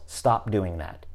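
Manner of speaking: ordinary delivery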